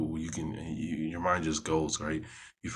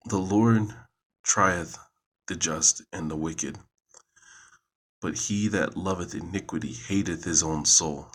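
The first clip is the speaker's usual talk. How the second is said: measured, solemn psalm reading, a little louder; scripture reading; 6 dB louder than usual. Acted. Reading a psalm aloud to listeners, solemn and measured. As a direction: read it out like scripture in church.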